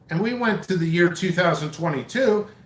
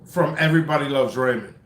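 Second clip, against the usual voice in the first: ordinary talk, nothing marked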